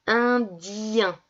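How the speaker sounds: "Indien" in two held syllables, long i into nasal un; drawn-out syllables. Shown as a demonstration. Teacher saying the word so pupils hear ien, drawn out. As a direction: stretch the dien of "Indien". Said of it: "J'entends ien".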